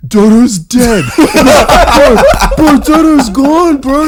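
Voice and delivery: high, loud panicked wail of bad news; panicked crying friend. Friend crying down the phone to Seth, panicked and high. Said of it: "he's crying on the phone".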